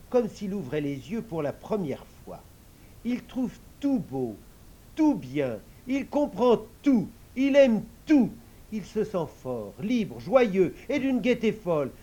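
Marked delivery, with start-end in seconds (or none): 3.0-11.9 building louder and higher; growing joy. A narrator's reading. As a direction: let the delight swell. Joy rising in narration.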